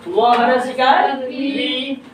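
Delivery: very slow व हरस इकार वी; spelling demonstration. Shown as a demonstration. Teacher spells the start of विज्ञान, slow demonstration. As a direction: stretch वी out for pupils to follow. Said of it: "तो इसको पढ़ेंगे".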